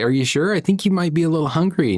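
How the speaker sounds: high, quick coaxing tone; coaxing parent. Retold or imitated. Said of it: "And I say".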